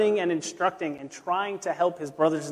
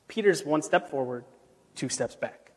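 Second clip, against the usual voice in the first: softer and lower, trailing off at the end; fading refrain; 3 semitones lower, 6 dB quieter than usual. Shown as a demonstration. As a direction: let the phrase die out. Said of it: "refrain repeated, fading".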